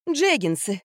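mildly insistent repeat; stubborn correction. A narrator's reading. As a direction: correct them doggedly. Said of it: "с упорством, достойным лучшего применения". (упрямая поправка)

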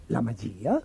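very low, soft query with a rising end; low, quiet puzzled question; hushed questioning echo. A narrator's reading. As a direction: echo it softly in disbelief. Emperor echoes the word, amazed.

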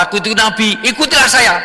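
high, pushy boast of a fake prophet; cocky liar, pitched high and loud at the end; lying self-proclaimed prophet. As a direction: sell the lie with rising insistence.

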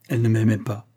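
ordinary delivery

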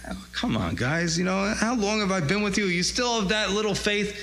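retold weary reproach to doubting friends; lightly acted disbelief, pitch climbing; mild exasperation. Jesus chides the frightened disciples.

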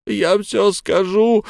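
high, slow, sobbing plea. A narrator's reading. Beaten captive begging his torturers, sobbing. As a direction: blurt it through sobs. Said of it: "всхлипывая".

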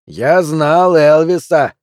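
slow and loud, breathing hard; heavy wheezing declaration. Acted. Drunk heckler wheezing at Pelvis, slow and loud. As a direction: puff it out like a drunk. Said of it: "тяжело сопя заявил Тощий".

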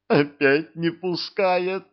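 high, slow whiny near-tears voice; almost crying in frustration. A narrator's reading. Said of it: "Чуть не заплакал он".

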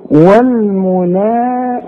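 والمنا with the alif held two counts, slow; drawn madd. Shown as a demonstration. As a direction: hold the long a in والمنا for two beats. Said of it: "شوف المدود قد ايه". (مد الألف في والمنا حركتين)

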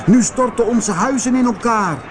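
high, hurried cry of townspeople; calling out. A narrator's reading. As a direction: complain in a quick, high-pitched call. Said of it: "riepen de mensen". (hoog en gehaast roepen)

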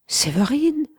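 a high, frightened cry of the name; startled cry. A narrator's reading. Mistress waking to find her lover weeping, frightened cry. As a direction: call out the name, startled and scared. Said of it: "s'écria-t-elle, plus effrayée que colère".

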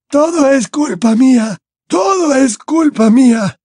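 very high, anguished wail; old man's tearful, high lament; sobbing. A narrator's reading. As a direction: wail in guilt and despair. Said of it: "sollozó el anciano".

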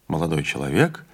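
ordinary delivery, nothing marked

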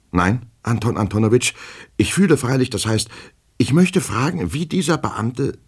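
high, faltering clerk voice; nervous, high-pitched false starts; agitated petty clerk. Acted. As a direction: grope anxiously for the question. Agitated clerk falters before superior.

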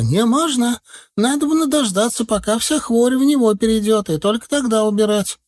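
high insistent granny voice; stubborn old healer. A narrator's reading. Бабка-целительница упрямо запрещает снимать горшок, высоко и настойчиво.

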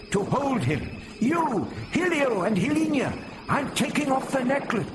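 put-on high, strained old leader's orders; commanding old man. A narrator's reading. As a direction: take charge urgently, pitched high. Old leader giving urgent orders.

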